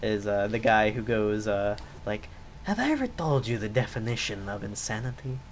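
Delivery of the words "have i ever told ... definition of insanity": Vaas voice. Retold or imitated. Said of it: "I was able to do".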